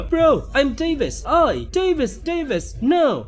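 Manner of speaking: high, excited fan calling out; eager self-introduction. Acted. Fan introduces himself to big bro, high and eager. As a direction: pitch it up, desperate to be noticed.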